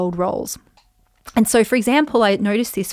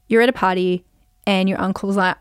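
usual speaking voice

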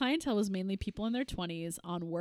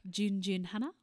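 put-on Australian accent; 6 dB louder than usual. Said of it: "constantly paging this stewardess".

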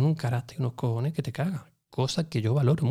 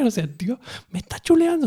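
mock outrage; 13 semitones higher, 6 dB louder than usual. Padre indignado al contarlo.

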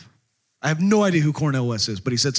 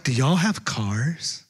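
slow, lower, dopey mock voice; mock clueless kid; 6 semitones lower, much slower than usual. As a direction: drag it out like a clueless kid asking. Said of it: "being asked, like".

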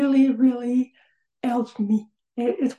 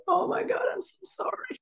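much higher, trailing off softer; mock shocked apology; 10 semitones higher, 5 dB quieter, faster than usual. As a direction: gasp it out like a mortified mom. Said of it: "And I'm like".

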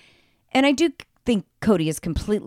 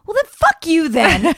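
louder, higher snappy comeback; mock blunt retort; 9 semitones higher, 7 dB louder than usual. Friend retelling Janelle's comeback, loud mock snap. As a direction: fire it back like a fed-up wife. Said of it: "And then she goes".

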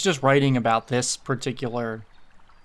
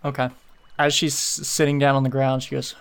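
normal talking voice

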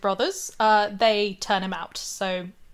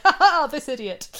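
higher, louder gleeful jeer; mocking laugh; 5 semitones higher, 6 dB louder than usual. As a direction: laugh at the fool. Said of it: "I got excited 'cause I'm like".

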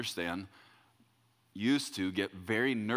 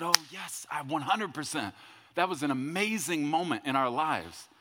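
higher-pitched, enthusiastic acted reply; retold eager agreement; 5 semitones higher than usual. Pastor answering the returning couple, acted eager agreement. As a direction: lift the pitch in keen recognition.

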